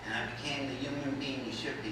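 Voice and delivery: normal talking voice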